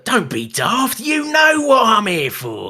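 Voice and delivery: loud, high mock Cockney accent; Cockney twang. Podcaster mocking the actor's accent work, shouted Cockney twang. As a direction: shout it in an exaggerated Cockney, drawing out the end. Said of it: "have this Cockney twang to it".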